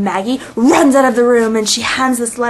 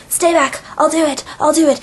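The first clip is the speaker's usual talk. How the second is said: higher, frantic retold warning; mock threat; 6 semitones higher than usual. Villain holding Sarah by the throat, acted frantic threat. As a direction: warn them off in mock desperation.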